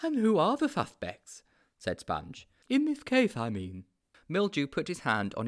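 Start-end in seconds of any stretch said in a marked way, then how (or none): 0.0-1.4 high-pitched, naive sidekick; high storybook character voice; Sponge's character voice. A narrator's reading. 2.7-3.8 high-pitched, naive sidekick; high storybook character voice; Sponge's character voice. A narrator's reading.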